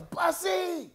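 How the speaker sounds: high, drawn-out call across a lobby; yelled nickname. Retold or imitated. Coach spotting his old player in a hotel lobby, retold yell. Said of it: "and he yells".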